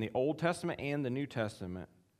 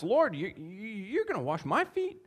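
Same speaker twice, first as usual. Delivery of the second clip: high, loud disbelief; can't believe what he's hearing; incredulous; 11 semitones higher, 5 dB louder than usual. Acted. Preacher voicing Peter's disbelief at Jesus washing feet.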